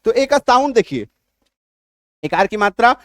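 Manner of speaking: normal talking voice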